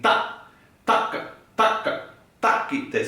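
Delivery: "taka" and "takite" hit on the opening "ta"; first-syllable accent. Shown as a demonstration. Teacher explains the accent mark, stressing each "ta". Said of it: "I'm always accenting the first syllables".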